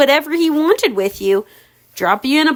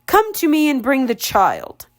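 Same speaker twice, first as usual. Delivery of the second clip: usual speaking voice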